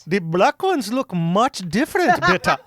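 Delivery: badly done Indian accent; hammed-up Indian accent, laughing. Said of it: "my Indian accent is so trash".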